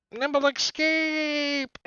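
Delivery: very high, drawn-out squeal; goblin exclamation. Acted. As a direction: squeal it out as you flee. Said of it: "and then he's like".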